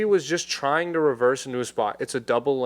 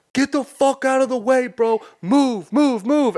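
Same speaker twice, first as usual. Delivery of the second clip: much higher, louder shouted threat; shouting; 12 semitones higher, 5 dB louder than usual. Retold or imitated. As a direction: roar at him to move out of the way. Four dudes surround car yelling.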